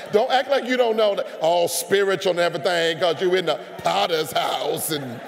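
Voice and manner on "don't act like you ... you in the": loud, teasing call-out to the crowd. Real, not acted. Preacher teasing the congregation at full volume. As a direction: raise it and call them on it.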